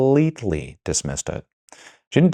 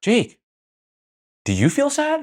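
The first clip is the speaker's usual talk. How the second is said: higher, incredulous imitation of Carly; mock disbelieving question; slower than usual. Imitating Carly quizzing Jake, high and disbelieving. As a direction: ask it like you can't believe anyone feels sad. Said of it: "she goes to Jake and she's like".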